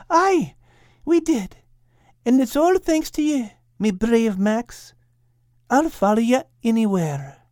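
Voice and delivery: tender little dog, grateful and sweet; Kate's lilting voice. Acted. Kate thanking brave Max on the sand, high tender voice.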